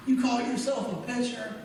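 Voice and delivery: high-pitched mocking jeer; heckling friend. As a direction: taunt him like a rowdy fan. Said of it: "He's going".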